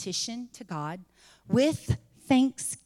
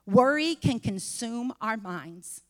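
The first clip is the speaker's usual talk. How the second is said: normal talking voice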